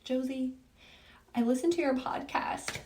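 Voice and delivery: soft, high-pitched hurt voice, understated; retold tearful mom. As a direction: lightly imitate an upset mother on the phone. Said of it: "And she's crying".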